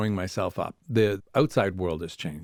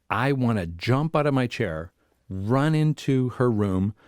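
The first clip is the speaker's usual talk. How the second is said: ordinary talk, nothing marked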